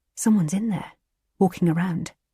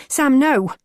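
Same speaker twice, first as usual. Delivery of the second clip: high, loud alarmed shout; 8 semitones higher, 6 dB louder than usual. A narrator's reading. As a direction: cry out to stop him. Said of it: "Alex shouts".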